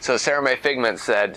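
ordinary delivery

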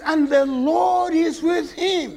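emotional preacher near tears on the verse; tearful verse. Shown as a demonstration. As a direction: let the voice break on the Lord. Same verse read again.

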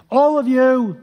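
loud, high royal command; commanding shout. Retold or imitated. King shouting his decree to all the people, loud. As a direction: bellow the order to the crowd. King's command in retold story.

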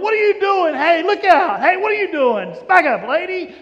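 loud, high angry shouting; yelling at her. Retold or imitated. Annoyed crowd yelling at the woman to back up. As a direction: shove her back with your voice. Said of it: "yelling at her".

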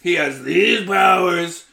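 loud, high rattled-off recap; exasperated mock shouting. Host voicing Marvel impatiently recapping Spider-Man's origin, mock shouting. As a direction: shout the backstory like it's obvious. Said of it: "they were like".

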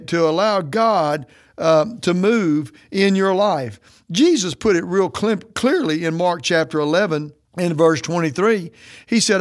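ordinary delivery

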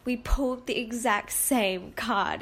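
normal talking voice

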